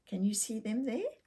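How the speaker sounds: ordinary delivery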